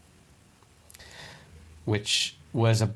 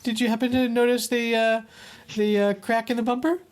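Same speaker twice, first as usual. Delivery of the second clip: fast high falsetto; high innocent voice; 12 semitones higher, faster than usual. Retold or imitated. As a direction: sneak the confession in falsetto. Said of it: "your voice goes high when you".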